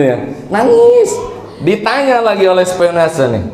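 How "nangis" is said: high, loud mock wail; wimpy mock crying. Shown as a demonstration. Preacher mimics the heartbroken man's wimpy crying. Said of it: "Nangisnya cemen gitu".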